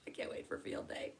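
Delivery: a quick private whisper of excitement; whispered aside. Real, not acted. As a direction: breathe it to yourself, eager. Excited aside about field day.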